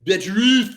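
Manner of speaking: "Better off" with a light French lilt; a Frenchified "Better off"; Frenchified pronunciation. Shown as a demonstration. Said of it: "we will change the pronunciation".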